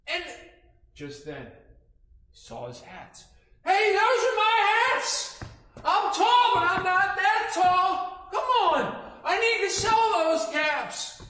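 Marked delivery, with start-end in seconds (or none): 3.6-11.2 loud, high, slow shout up at the tree; angry shout. Acted. Peddler yelling up at the animals in the tree, loud and high. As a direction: yell up at the thieving animals.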